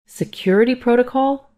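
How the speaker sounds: high, incredulous echo rising into a question; mild near-scream. A narrator's reading. Mother echoing the nurse's refusal, mild near-scream. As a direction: throw it back high, in disbelief. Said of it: "My voice was almost a scream".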